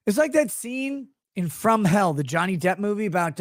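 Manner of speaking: usual speaking voice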